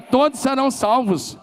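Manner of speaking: high, loud, drawn-out shout; shouted declaration. Shown as a demonstration. Pastor leading the congregation in a shouted declaration. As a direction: shout it out, stretch the last words.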